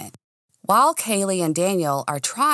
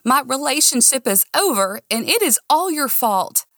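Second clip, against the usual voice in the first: tearful blame; 7 semitones higher than usual. A narrator's reading. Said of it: "tears sprung from my eyes".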